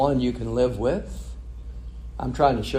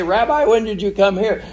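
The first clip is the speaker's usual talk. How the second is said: high, louder, eager mock questioning; excited crowd; 10 semitones higher, 8 dB louder than usual. The crowd chasing after Jesus finds him, mock excited. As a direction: call it out like thrilled followers who found him. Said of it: "They're all excited now".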